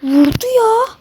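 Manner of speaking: high startled yelp of disbelief; shocked outcry. Real, not acted. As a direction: cry out as the shot lands. Shot again by an opponent.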